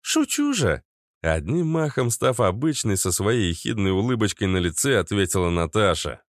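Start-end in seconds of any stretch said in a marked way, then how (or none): 0.0-0.8 put-on raised, sly teasing girl; high playful female voice. A narrator's reading. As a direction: laugh it off brightly, much higher. Natasha laughing it off.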